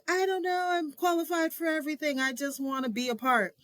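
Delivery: high, eager and earnest. Retold or imitated. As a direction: plead to be included. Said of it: "I was like".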